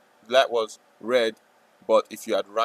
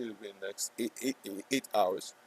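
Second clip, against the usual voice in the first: stutter; 3 semitones lower, 11 dB quieter than usual. Real, not acted. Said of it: "What was that stutter?".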